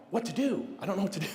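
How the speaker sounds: put-on high-pitched, flustered kid; mock panic. Nine-year-old watching his friend get stung, mock panicking. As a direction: act out helpless childhood panic.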